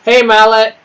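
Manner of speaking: loud, high call to a chatter. Real, not acted. Streamer hailing Mallet in chat, loud and high. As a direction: call his name across the room.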